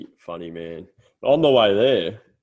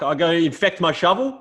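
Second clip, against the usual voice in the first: higher rising disbelief; incredulous old man; 9 semitones higher, 5 dB louder than usual. Retold or imitated. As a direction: ask it as if it's absurd. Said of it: "He goes".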